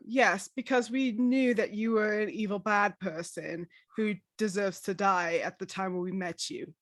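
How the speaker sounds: ordinary delivery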